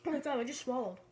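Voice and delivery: talking with a mouth full of crackers; words round dry crumbs. Real, not acted. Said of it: "my mouth is so dry".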